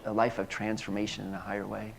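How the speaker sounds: ordinary talk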